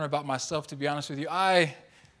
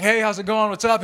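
wide-awake, higher and louder hello; chipper greeting; 5 semitones higher, 8 dB louder than usual. Retold or imitated. Husband home from the gym greeting his sleepy wife, chipper. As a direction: burst in brightly, full of morning energy.

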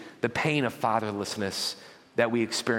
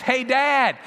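put-on high, loud kid yelling from the high dive; insistent, excited child's shout; kid calling out to Dad; 10 semitones higher, 3 dB louder, much slower than usual. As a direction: beg Dad to watch, pitched way up. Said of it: "see a kid on the top of the high dive".

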